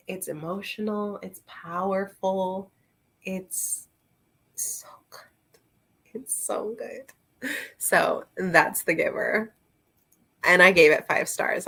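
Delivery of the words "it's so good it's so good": much softer, breathy whisper of praise; whispered gushing. Real, not acted. Telling viewers how The Giver feels, in a hushed whisper. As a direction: whisper it like a precious secret.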